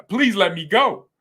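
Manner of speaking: ordinary delivery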